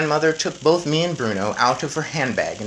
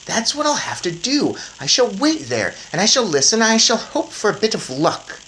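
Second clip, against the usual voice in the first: put-on lightly higher boy voice; boy mouse; 9 semitones higher, 5 dB louder than usual. A narrator's reading. Boy reminding grandmother, light eager boy voice. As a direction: point it out eagerly.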